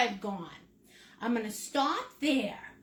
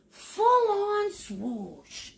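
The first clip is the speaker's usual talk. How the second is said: slow, higher, stretched-out "full-on swoosh"; swoosh noise; 6 semitones higher, much slower than usual. Shown as a demonstration. Painting teacher showing the big stroke, drawn-out swoosh noise. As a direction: draw it out like a sweeping brushstroke. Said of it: "Make that noise".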